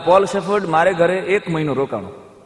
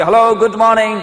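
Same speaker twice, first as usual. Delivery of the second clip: bright, raised hello from the visiting European; cheery foreigner greeting; 6 semitones higher, 5 dB louder than usual. Retold or imitated. European guest greeting the neighbour across the street, cheery and raised. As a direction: call across the street like a chirpy tourist.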